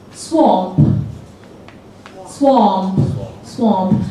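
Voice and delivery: British-Scottish swamp with a rounded vowel; Scottish-tinged swamp. Shown as a demonstration. Teacher demoing Shrek's British-Scottish swamp. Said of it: "how does he say swamp".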